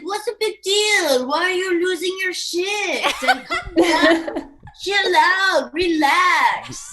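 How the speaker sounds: put-on a dismissive French accent, teasing. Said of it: "That's a good impression".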